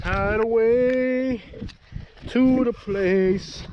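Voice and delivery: drawn out very slowly, ominous; slow dramatic echo. Acted. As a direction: stretch each word like a trailer voiceover. Dramatic echoed slow repeat.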